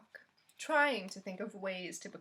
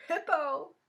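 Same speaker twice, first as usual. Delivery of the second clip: higher and louder laughing cry; delighted cry; 6 semitones higher, 7 dB louder than usual. A narrator's reading. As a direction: burst out the name with glee. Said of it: "Fluffy cried".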